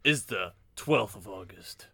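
slow, measured show-intro announcement; spaced-out announcer delivery. Pretend. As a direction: put on a slow podcast intro voice. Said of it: "intro voice here".

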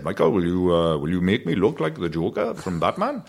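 put-on dad's lilting Welsh accent. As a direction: imitate a Welsh dad asking his son. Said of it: "'cause he's Welsh".

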